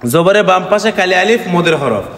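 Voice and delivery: normal talking voice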